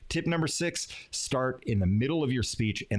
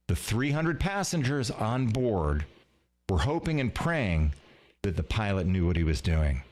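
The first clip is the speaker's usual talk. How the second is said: riveting story opener; 5 semitones lower than usual. Shown as a demonstration. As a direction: pull the audience in with suspense. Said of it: "Here's an example".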